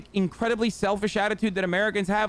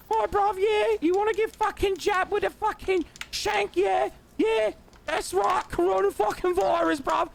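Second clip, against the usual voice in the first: much higher, shouty London chav accent mockery; mocking British bruv; 10 semitones higher than usual. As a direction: ham up a cheeky British lad, yelling. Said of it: "we make fun of bruvs here all the time".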